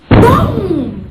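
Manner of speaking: shouted boom sound effect; loud boom. Retold or imitated. Being dropped on the grass, loud boom. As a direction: slam the word out loud.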